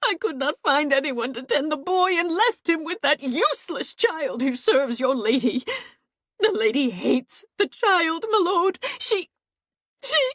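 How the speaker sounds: high, whining, pleading tone; wheedling. A narrator's reading. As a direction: coax him, breaking into tears. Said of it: "her voice no longer shouting, but wheedling".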